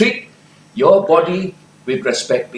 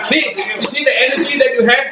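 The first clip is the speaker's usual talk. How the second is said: fast, higher, through laughter; laughing while talking; 10 semitones higher, faster than usual. Real, not acted. Leader praising the audience after the laugh chant, laughing. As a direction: bubble over with laughter.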